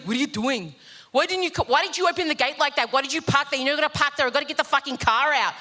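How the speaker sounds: high, quick-fire mock nagging; hectic dad at home. Imitating dad coming home to a blocked gate, hectic. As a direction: fire off complaints like an exasperated father. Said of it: "when Dad gets going and we're at home together, it's hectic".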